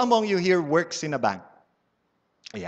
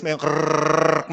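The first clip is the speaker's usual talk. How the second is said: mock whirring machine; 5 dB louder than usual. Imitating bill counter.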